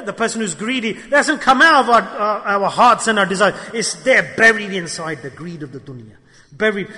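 ordinary talk, nothing marked

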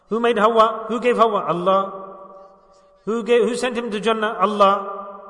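usual speaking voice

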